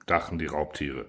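ordinary delivery

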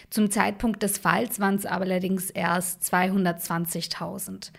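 usual speaking voice

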